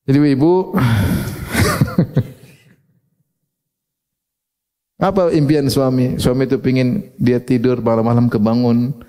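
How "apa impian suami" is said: ordinary talk, nothing marked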